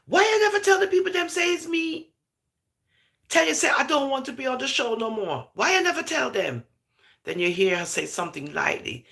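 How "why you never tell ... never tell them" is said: mock confrontation. Retelling Erica's angry phone confrontation.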